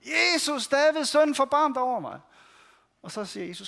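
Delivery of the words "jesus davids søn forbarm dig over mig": higher, louder pleading shout. Retold or imitated. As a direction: shout over the crowd that tries to hush you. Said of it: "råber han bare endnu højere". (højere, råbende bøn)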